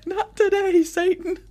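high laughing mock rebuke. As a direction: laugh it out, playfully scolding. Joking after holy beer burn.

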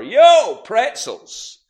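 imitating a pretzel seller, loud high holler; long ringing call, then the product; street vendor's shout. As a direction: hawk your wares at full voice. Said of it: "they would just shout".

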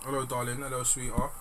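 soft, low seductive purr; breathy come-hither greeting; femme fatale. Pretend. Playful seductive greeting, ASMR bit.

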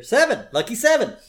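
high, loud call; mock dice-call shout. The dealer calls Homer's roll, high and loud. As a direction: shout it over the casino.

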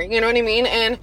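ordinary talk, nothing marked

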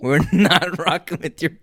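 slow, high, laughing mock announcement; cracking up while imitating an official warning; laughing through quote. Cracking up voicing school board.

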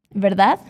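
"Verdade?" lifted sharply at the end like a check; questioning rise. Shown as a demonstration. Teacher modelling "Verdade?" as a question tag for students. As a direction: ask "Verdade?" as a quick confirming question. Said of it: "Mais uma vez".

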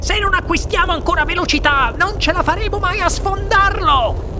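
high-pitched, frantic urging; excited, high insistence; urgent order. A narrator's reading. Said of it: "ordinò il signor Wonka".

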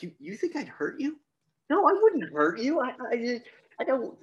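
rising to a high, loud, manic pitch; a lunatic insisting he's harmless; unhinged reassurance. Retold or imitated. Gunman reassuring the frightened family, high and manic. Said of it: "And he's like".

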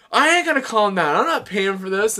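high, loud, defiant refusal; retold angry flip-out. Drunk diner refusing to pay the bill, acted flip-out. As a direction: imitate an outraged drunk refusing to back down. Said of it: "he flipped the fuck out".

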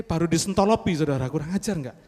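ordinary talk, nothing marked

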